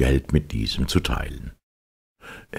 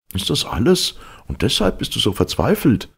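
much higher, amused disbelief; surprised old friend; 8 semitones higher than usual. Acted. Old friend teases the despairing servant, high surprised voice. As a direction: friend scoffs at the servant's worry, pitch jumps up. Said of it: "fragte ihn sein alter Freund".

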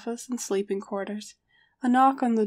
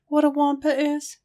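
raised, beseeching question; begging; 6 semitones higher, 4 dB louder than usual. A narrator's reading. Harry asks the others to explain, begging. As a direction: plead to be let in on it. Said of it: "Harry begged".

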